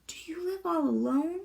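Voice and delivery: anxious whisper; anxious Annika. A narrator's reading. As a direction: ask it timidly under your breath. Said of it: "Annika said anxiously".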